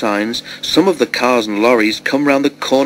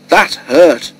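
higher, louder hurt protest; pained exclamation; 5 semitones higher, 4 dB louder than usual. A narrator's reading. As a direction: exclaim it, smarting from a knock. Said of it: "she exclaimed".

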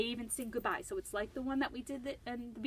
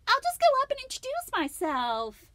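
put-on high, bright little girl voice, much higher; Lucy's voice; 10 dB louder than usual. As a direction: play Lucy cheerful and eager. Lucy's voice in read story.

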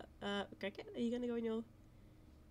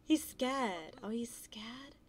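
whisper; 2 semitones higher, slower than usual. Real, not acted. Gamer whispering to a frightened horse. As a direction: hush it so the horse stays calm.

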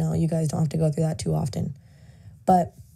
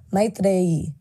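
Tamil accent. Shown as a demonstration. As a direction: say "Maitreyi" slowly as MY-th-ray-ee, Tamil style. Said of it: "put a Tamil accent on it".

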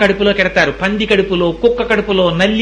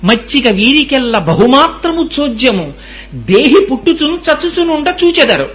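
verse recitation; 4 semitones higher than usual. Acted. Preacher repeats verse about birth and death, chanted recitation. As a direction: intone it with stretched syllables.

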